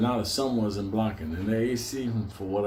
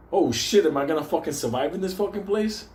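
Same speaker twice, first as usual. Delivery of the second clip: higher, louder startled yelp of panic; mock panic; 7 semitones higher, 3 dB louder than usual. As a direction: play the scared tenant in a racing lift. Panicking in speeding elevator.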